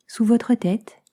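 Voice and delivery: ordinary talk, nothing marked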